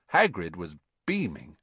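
plain reading voice

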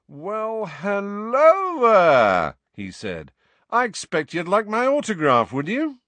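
high, drawn-out smug greeting; vain celebrity. A narrator's reading. Vain ex-teacher greeting students, smug and grand. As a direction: preen as if adored.

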